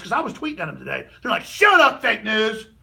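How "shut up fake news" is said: high, loud mock yell of critics; mocking shout. Quoting leftists on Twitter, mocking loud shout. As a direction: bark it back like an angry troll. Voicing online critics yelling.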